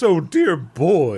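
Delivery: high, slow upper-class lady voice; Miss Hathaway impression. Podcaster imitating a posh film character, high and slow. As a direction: drawl it like a prim society dame. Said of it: "she sounds like Miss Hathaway from the Beverly Hillbillies".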